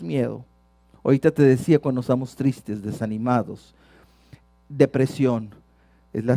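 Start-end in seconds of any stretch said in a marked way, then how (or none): none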